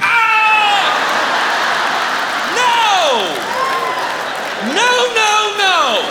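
high, loud cry of horror and denial; horrified screaming. Retold or imitated. Dad learning the panties are his daughter's, horrified screaming. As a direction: wail "no" like a dad scarred for life.

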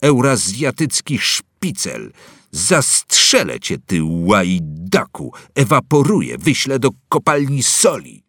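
put-on loud, slow, savage child's shouting; child's furious yelling. Boy threatening Winston, shouting furious accusations. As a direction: scream threats like a little zealot. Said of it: "wrzeszczał dzieciak".

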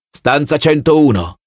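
slow, level, unmoved order; officer coldly repeating the sentence; cold officer reply. Acted. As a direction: answer the begging man flatly. Officer answers pleading prisoner.

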